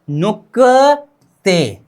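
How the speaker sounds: नुकते drawn out very slowly, syllable by syllable; slow demo. Shown as a demonstration. Teacher modelling the Korean word for students, very slowly. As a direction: stretch नुकते out slowly for the class. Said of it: "क्या?".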